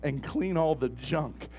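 building from steady to a loud shout; preaching at full force. Acted. Pastor rouses the congregation, building to a shout. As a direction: preach it up to a roar.

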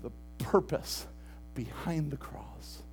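soft, slow preaching, almost confiding; hushed sermon. Acted. Pastor softly tells the congregation about the cross, hushed. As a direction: draw the church in quietly.

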